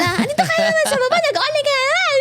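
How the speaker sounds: high-pitched imitation of scolding parents, half laughing; mock angry parents. Daughter imitating her parents catching her online downstairs, mock angry. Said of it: "galit na galit naman sila".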